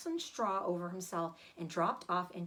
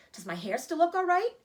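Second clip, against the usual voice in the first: anxious; 8 semitones higher, 6 dB louder than usual. Acted. Wife anxiously asking.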